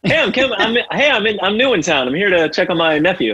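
high, chirpy put-on newcomer voice; naive newcomer. Newcomer in town asking after a nephew, high put-on voice.

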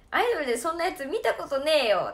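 hammed-up grumpy farmer telling her off; mock gruff scolding. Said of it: "めっちゃ罵倒されてて". (おじさんが乱暴に叱る大げさな真似)

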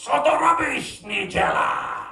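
high, hammed-up broken-language yell; mock angry shout. A jogger yelling a mangled insult at a dog owner, mock angry. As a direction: blurt it out like a furious foreigner. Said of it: "the only thing I could think to say was".

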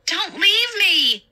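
high, slow, drawn-out wail; wailing plea. Acted. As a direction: cry out not to be left alone. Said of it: "Angela wailed".

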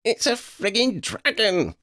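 high-pitched indignant squawk; high, exasperated outburst. A narrator's reading. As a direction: squawk it in disbelief. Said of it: "Lydia squawked".

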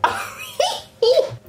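high, squealing giggle; a shrieking burst of laughter; delighted high squeal of laughter. Real, not acted. Reacting to lip scene.

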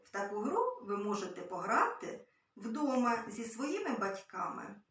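ordinary talk

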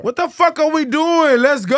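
high, loud rallying shout; hyped shout. Real, not acted. Rallying the crew to get going, loud and high. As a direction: fire everyone up, loud. Hyping up the room.

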